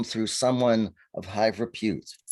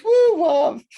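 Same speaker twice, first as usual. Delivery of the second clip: a high, loud woo of delight; giddy celebratory hoot; excited whoop; 8 dB louder than usual. Real, not acted. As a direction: cheer the word like a fan. Cheering the word love.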